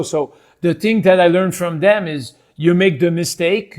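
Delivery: French Canadian accent with deliberately clumsy English; Quebecois accent. Shown as a demonstration. Explaining what was learned from TV speakers, Quebecois accent. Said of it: "you just hear Quebecois speakers".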